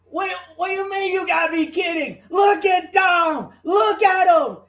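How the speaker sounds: high, hammed-up commentator shout; indignant shouting. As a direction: yell it in outraged disbelief. Acting out shouted commentary reply.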